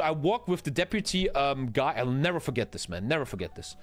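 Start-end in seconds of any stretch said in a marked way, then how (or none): none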